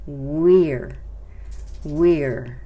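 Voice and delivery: "we're" firm and loud, one falling syllable. Shown as a demonstration. Teacher repeats the contraction for learners, "we're" loud in one syllable. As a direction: make each "we're" a single strong beat. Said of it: "Se pronuncia como una sílaba".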